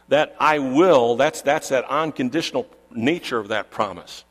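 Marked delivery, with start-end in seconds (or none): 0.4-1.1 "will" punched loud; forceful, louder "will"; emphatic stress. Shown as a demonstration. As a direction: hit "will" hard as an unconditional pledge. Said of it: "It's emphatic".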